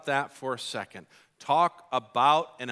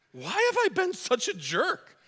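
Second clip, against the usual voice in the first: very high, comic wail of realisation; mock self-reproach; 13 semitones higher than usual. Dad suddenly realising he was awful, mock dismay. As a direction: squeal it in comic dismay at yourself.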